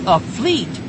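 high-pitched cry of alarm; urgent cry. A narrator's reading. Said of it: "with an urgent bellow".

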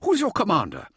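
high, demanding challenge; affronted and insistent; indignant exclamation. A narrator's reading. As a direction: demand it with pitch shooting up. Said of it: "Mirza exclaimed, pushing forward".

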